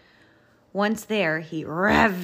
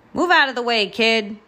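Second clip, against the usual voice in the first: loud, raised order; roaring roller truck; 5 semitones higher, 6 dB louder, faster than usual. A narrator's reading. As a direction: bellow it like a bossy big truck. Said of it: "roared Roller".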